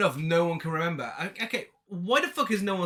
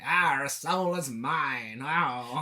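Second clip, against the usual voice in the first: slow hammy villain voice; pantomime villain; much slower than usual. Retold or imitated. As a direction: ham it up like a panto baddie. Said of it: "sounds like a fucking pantomime villain".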